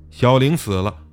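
plain reading voice

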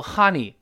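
"honey" loud, with an open "ah" as in "hah-nee"; wrong vowel. Shown as a demonstration. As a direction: mispronounce "honey" as "ha-ni", hard and loud. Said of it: "但不要读honey".

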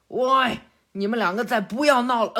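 shouting from outside. Acted. Father outside to his fighting kids, shouting. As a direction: yell at them to stop. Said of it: "还在外面大喊呢".

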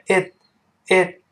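"it" stopped with no burst on the T; "it", tongue pressed, T left unreleased. Shown as a demonstration. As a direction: hold the breath on the T of "it". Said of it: "it 那个t也失去爆破了".

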